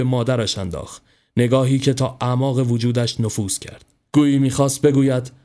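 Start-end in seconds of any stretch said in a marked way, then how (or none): none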